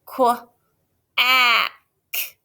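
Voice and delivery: qu, ah, k split into sounds; sounding out. Shown as a demonstration. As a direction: tap out Qu-, ah, k. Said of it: "Here we go".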